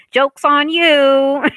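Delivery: loud, high, drawn-out taunt; stretched-out, singsong gloating; gleeful gotcha. Retold or imitated. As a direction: crow it slowly in triumph. Crowing at dates who underestimated her.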